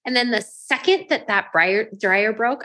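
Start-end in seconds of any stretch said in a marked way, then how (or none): none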